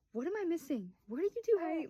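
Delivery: mock-baffled questioning; amused bewilderment. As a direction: demand to be let in on it, playfully. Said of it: "laughing, but also, like, what's going on".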